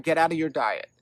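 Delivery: ordinary talk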